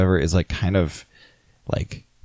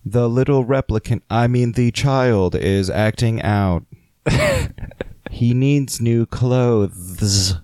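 robot voice; 2 semitones higher, slower than usual. Pretend. Robot pretending to be human.

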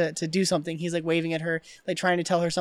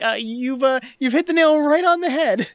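stammering, then high and loud; mock flustered agreement; 6 semitones higher, 5 dB louder than usual. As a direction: fumble then blurt it out loudly. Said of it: "Ed's like".

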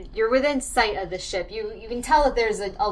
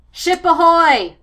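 loud lookout call across the deck; shouting from crow's nest; 9 semitones higher, 13 dB louder, slower than usual. Acted. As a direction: bellow it from the masthead. Crow's nest lookout calls ship.

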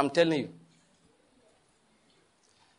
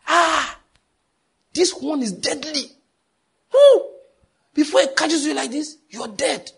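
mock panic, much higher and louder, with a shrill woo; panicking voice; 12 semitones higher, 6 dB louder, slower than usual. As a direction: imitate the consultant's frantic alarm. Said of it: "He came with a panicking voice".

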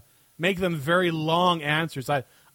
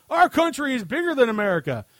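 higher-pitched mock boast; mock defensive Canadian; 9 semitones higher, 4 dB louder than usual. Host mocking intimidated Canadians who spout this, higher mock-defensive voice. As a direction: blurt it like a rattled, boastful Canadian. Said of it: "uneasy and intimidated Canadians sometimes spot out".

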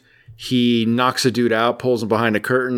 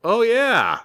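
high dopey "oh, yeah"; a mock sudden realization; dawning realization; 12 semitones higher than usual. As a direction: sound slow on the uptake. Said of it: "And everybody goes".

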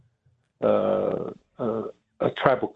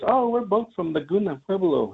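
put-on cheerful old man; 9 semitones higher than usual. Old man answers the officer.

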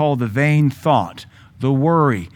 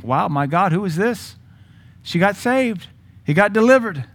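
mock amazement; 5 semitones higher than usual. Imitates people's amazed reaction.

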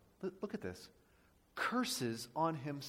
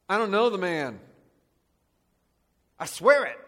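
loud, higher, forceful protest; sworn denial; 3 semitones higher, 11 dB louder than usual. Acted. Peter lies to the bystanders under oath, loud and insistent. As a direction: swear it out hard and loud. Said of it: "using an oath to lie".

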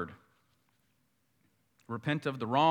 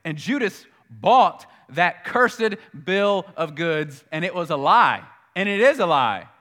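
preaching at full force; 4 semitones higher, 11 dB louder than usual. Acted. Preacher denouncing the lie to the congregation, loud. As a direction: thunder it out from the pulpit.

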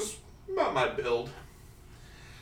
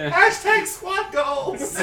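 mock hip slogan; 13 semitones higher, 9 dB louder, much slower than usual. Joking catchphrase, laughing.

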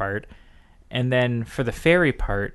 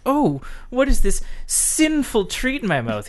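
higher, louder swooning voice; mock delight; 11 semitones higher, 5 dB louder than usual. Courtiers tasting chocolate, high hammed-up wonder. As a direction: gush over a forbidden treat. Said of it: "when they were like".

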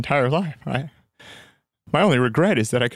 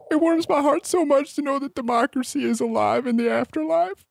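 crying. Acted. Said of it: "You're crying".

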